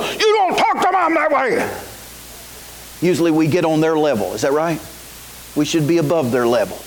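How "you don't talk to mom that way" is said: a higher, sharp scolding parent; stern scolding. Shown as a demonstration. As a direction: scold the teen firmly, pitched higher. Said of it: "much more done by whispering".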